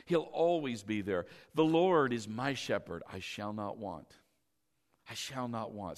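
quiet, prayerful recital of the psalm; soft scripture recitation. Acted. Pastor softly reciting Psalm 23 to the congregation, hushed recital.

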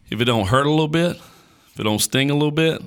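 fast, lifted preaching punch; preaching push. Real, not acted. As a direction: build the preacher's fervor. Emphatic preaching climax.

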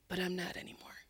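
hushed, almost whispered admission; moved confession. Retold or imitated. Composed man in tears admits his change, hushed. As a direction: let it drop to a humbled near-whisper.

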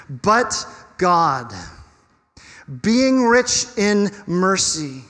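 slow, emphatic scripture reading with weighty pauses. Real, not acted. Preacher slowly reading verse four to the congregation, emphatic. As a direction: let each phrase land, slowly and firmly. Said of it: "go a little bit slower".

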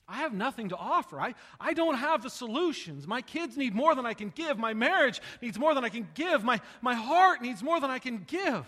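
loud pleading preacher voice; impassioned preaching. Acted. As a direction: plead it out, building.

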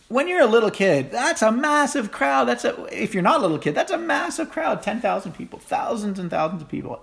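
high-pitched, awestruck emphasis on the size; excited amazement. Real, not acted. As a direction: marvel at it, voice soaring high. Impressing on listeners the crowd's size.

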